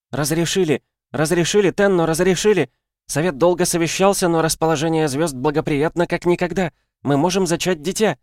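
put-on high, breathless delighted girl voice; bursting with happy news; overjoyed. A narrator's reading. Joyful news, crystal female voice.

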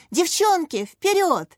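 high, urgent rallying cry; straining cheer. A narrator's reading. Katya urging on her gymnasts, straining cheer. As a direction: spur the girls on with all you have. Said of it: "срывала голос Катя".

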